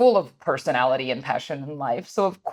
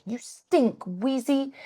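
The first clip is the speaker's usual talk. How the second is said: put-on higher, soft little-kid voice; lightly voiced toddler, a bit softer; imitating toddler; 8 semitones higher, 4 dB quieter, much slower than usual. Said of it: "He was like".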